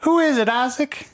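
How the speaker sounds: put-on high old woman calling from the door; old lady. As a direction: call out like a grandma. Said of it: "She goes".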